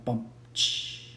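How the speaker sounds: soft spoken joke drum sting; vocal rimshot. Pretend. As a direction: mimic a drum-and-cymbal punchline sting. Mimics rimshot after own pun.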